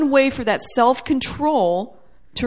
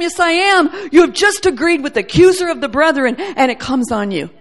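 louder, higher insistent defense; advocate's loud plea; 6 semitones higher, 8 dB louder than usual. Acted. Jesus as advocate answering the accuser, loud and high. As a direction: proclaim it like a high priest pleading. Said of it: "And he's saying".

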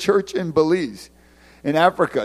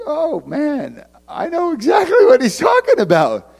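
excited recognition; 11 semitones higher, 8 dB louder than usual. Retold or imitated. Inner reaction to hearing him.

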